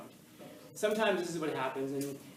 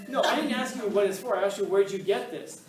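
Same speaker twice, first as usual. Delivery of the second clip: raised, higher voice, mock exasperation; exasperated questioning; 4 semitones higher, 10 dB louder than usual. Teacher grilling students over soda, exasperated. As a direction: re-enact pressing them loudly for an answer.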